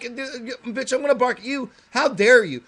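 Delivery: retold mock rant at the neighbor's dog; yelling at a dog. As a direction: scold the dog like she insulted you. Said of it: "yelling at her".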